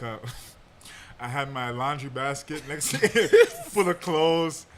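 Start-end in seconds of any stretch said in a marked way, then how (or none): 3.0-3.7 a sharp squeal of laughter; laughing at his own memory; sudden high laugh. Real, not acted. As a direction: burst out in a high, loud laugh. Laughs mid-story.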